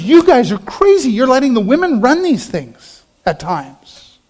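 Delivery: loud, mocking "you guys are crazy"; scoffing outsiders. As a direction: scoff at them like a disbelieving world. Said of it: "the rest of the world goes".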